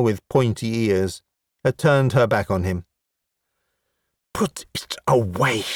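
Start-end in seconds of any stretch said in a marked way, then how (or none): 4.3-5.6 sharp whispered hiss. A narrator's reading. Woman to a half-dressed stranger, hissing in disgust. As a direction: hiss it with hardly any voice. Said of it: "she hissed".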